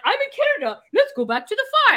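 high, silly sing-song mock; mocking self. Answering her ancestors, high mocking irony. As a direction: play the ironic descendant.

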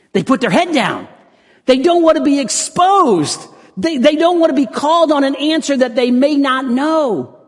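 high, loud preacher's cry; full-force preaching. Real, not acted. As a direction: ring it out to the whole congregation.

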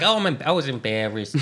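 lightly voiced Pesci, sheepish excuse; quick, lightly done Pesci mimicry; Joe Pesci impression. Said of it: "killer Joe Pesci impersonation".

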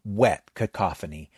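ordinary delivery, nothing marked

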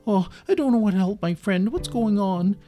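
high, loud fretting cartoon dog; worried dog. Doggy pacing, unable to help Kitty, worried. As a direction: fret aloud with helpless worry. Doggy frets for his friend.

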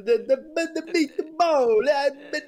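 high, sing-song mock Italian accent; bad Italian accent. Shown as a demonstration. Comic shows the bad accent over restaurant music, high cartoon Italian accent. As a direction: chant it in an exaggerated fake Italian accent. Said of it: "that's the bad one".

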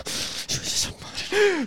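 very high, thin comic squeak; squeaky mock request. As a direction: squeal it out softly through the laughter. Squeaky replay of the quote while laughing.

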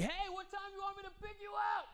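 high distant call of a cab-driver dad; dad yelling. Retold or imitated. Dad yelling from the cab window to his son. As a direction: shout it out the taxi window. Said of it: "Why is your driver yelling at you?".

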